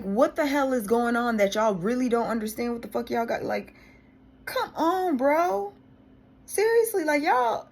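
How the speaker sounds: loud, high-pitched outraged venting; real exasperation, voice climbing; exasperated rant. Real, not acted. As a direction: let the frustration blow up. Ranting about phone bill.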